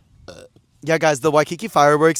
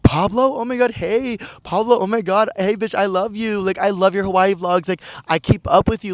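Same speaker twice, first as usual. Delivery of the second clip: gushing Bretman; 7 semitones higher, 4 dB louder than usual. Retold or imitated. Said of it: "he was immediately like".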